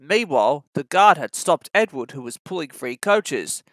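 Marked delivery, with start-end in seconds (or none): none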